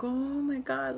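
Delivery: delighted "Oh my God" at a first kick; excited gasp. Retold or imitated. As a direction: relive the thrill of the first kick. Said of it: "like the first time".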